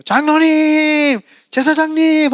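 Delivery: high, loud slow call for the elders. Shown as a demonstration. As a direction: shout it out at the gate. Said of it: "소리를 질러야 돼요".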